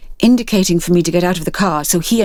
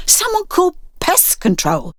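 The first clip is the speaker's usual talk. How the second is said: wry joke; 11 semitones higher than usual. A narrator's reading. Clyde jokes at the worms.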